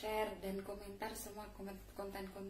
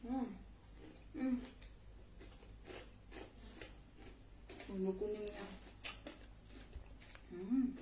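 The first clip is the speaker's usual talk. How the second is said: talking with mouth full; muffled hums while chewing; chewing food. Real, not acted. Seen 0: eats by hand, cheeks bulge and jaw chews through words.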